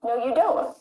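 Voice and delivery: ordinary delivery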